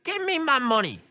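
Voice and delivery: put-on high, squeaky old lady demand. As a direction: squeak it out like a grandma robbing a bank. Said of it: "I can't do an old lady voice".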